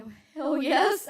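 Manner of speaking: high, loud, eager yes; emphatic agreement. Retold or imitated. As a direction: burst out with a wholehearted reply. Acts out her eager reply.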